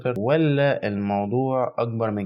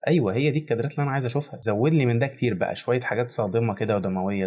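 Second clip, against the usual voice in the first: eager excitement; 2 semitones higher than usual. Real, not acted. Vlogger begging the trailer for more gore, fast and eager. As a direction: gush like a horror fan. Thrilled by gory shots.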